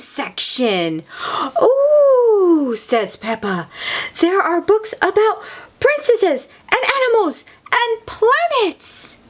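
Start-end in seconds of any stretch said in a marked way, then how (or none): 1.6-2.7 high, loud, drawn-out ooh; delighted ooh. A narrator's reading. Peppa delighted by children's books. 4.2-9.2 very high, thrilled little voice; excited squeal of a little pig; excited child character. A narrator's reading. Peppa delighted by the books.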